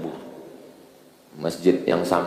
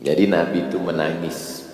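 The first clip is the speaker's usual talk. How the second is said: ordinary talk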